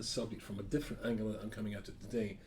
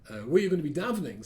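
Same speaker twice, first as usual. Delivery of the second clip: eager question; 6 semitones higher, 9 dB louder, faster than usual. Retold or imitated. Naive young man asks eagerly.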